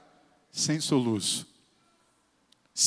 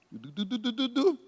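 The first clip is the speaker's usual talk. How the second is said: soft quick du du du; imitated gulping; 6 dB quieter than usual. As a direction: gulp it out in little beats. (goles imitados, baixinhos e rápidos)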